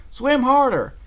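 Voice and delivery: high, loud command; urgent shout. A narrator's reading. Applejack to her friend in the river, shouting urgently. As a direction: yell it as an urgent order.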